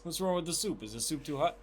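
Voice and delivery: a hint of a fussing movie character; movie quote impression. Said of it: "have you seen Coming to America?".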